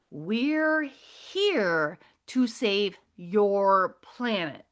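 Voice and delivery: very slow, word-by-word proclamation; alien woman proclaiming. Retold or imitated. Alien woman announcing her mission, slow drawn-out proclamation. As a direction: draw out every word as the alien. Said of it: "She goes".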